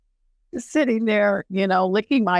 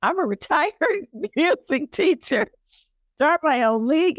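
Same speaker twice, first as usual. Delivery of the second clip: high, laughing incredulous protest; amused disbelief; 9 semitones higher than usual. Retold or imitated. Retired music teacher questioning God's call, amused disbelief. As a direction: protest as if the idea is absurd.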